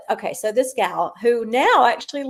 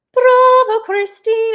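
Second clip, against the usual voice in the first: high, loud stage voice; imitating the ballerina; 13 semitones higher, 11 dB louder, slower than usual. Singer imitating the ballerina's line, high and loud. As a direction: call it out high like the dancer on stage. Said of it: "she says".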